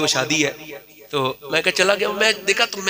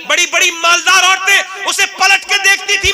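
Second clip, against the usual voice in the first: high, loud building climax, then slow; preacher climaxes on truth and honour; fiery preaching; 13 semitones higher, 6 dB louder than usual. Real, not acted. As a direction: thunder the moral home. Preaching at full force.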